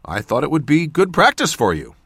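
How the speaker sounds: ordinary delivery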